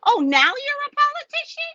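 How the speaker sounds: high, mocking sneer at the commissioner; scornful jab at the commissioner turned polite. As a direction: throw the question back with scorn.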